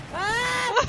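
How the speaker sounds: long, high, loud scream. Real, not acted. Player cursing teammates in the match, long high scream. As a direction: let out a drawn-out high yell.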